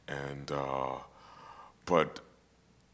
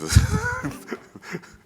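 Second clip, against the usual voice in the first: high laugh; 8 dB louder than usual. Real, not acted. Laughs mid explanation.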